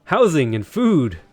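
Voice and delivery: exasperated leader. Acted. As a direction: tell off a useless scout, punching key words. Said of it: "It's like".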